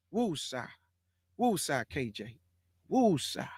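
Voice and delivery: low, soft self-soothing breaths of woo-sa; a hushed calming mantra to cool off; calming himself down. Real, not acted. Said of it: "Let me calm down and take me a deep breath".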